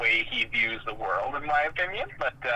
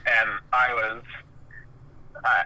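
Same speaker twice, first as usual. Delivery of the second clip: ordinary delivery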